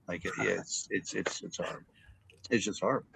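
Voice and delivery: dropping to a near-hush, heavy and sorry; softly grieving announcer breaking bad news; sorrowful hush. Shown as a demonstration. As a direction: let it sink low like bad news hurts you too. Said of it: "you shake your head".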